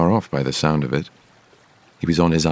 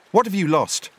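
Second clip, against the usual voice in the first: high-pitched questioning call; Bilbo calling out. A narrator's reading. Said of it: "Bilbo called".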